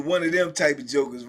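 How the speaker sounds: ordinary delivery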